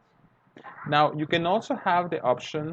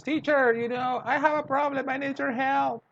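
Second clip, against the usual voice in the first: high pleading student voice; student Joanna begging the teacher for homework help, high; 12 semitones higher than usual. Retold or imitated. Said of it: "And she says".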